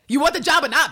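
high, loud imitation of a fed-up boss; mock angry ultimatum. Coach pressures pupil to take job, mock anger. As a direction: demand an answer, playing tough.

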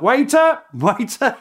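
high, loud mocking call of waiter; mock shout. British soldiers teasing the Germans, mock shouted call. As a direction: jeer it across like hailing a waiter. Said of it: "the British would shout".

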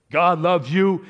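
high, loud booming cry of God loves you; hammed-up revival shout; imitated preacher yell. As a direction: boom it out like a preacher at full force. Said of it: "he's at a full force yell".

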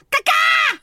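high shrill squawking bird cry; bird call impression. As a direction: squawk it like a parrot. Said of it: "I do a caca".

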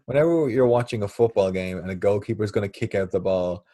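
usual speaking voice

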